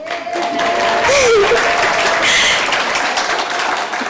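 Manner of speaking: a long, high, airy laugh; high airy laugh after the cheers; high breathy laugh. Real, not acted.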